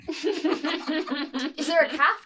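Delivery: clerk voice breaking into laughter, confused; laughing clerk. Acted. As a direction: puzzle over it while cracking up.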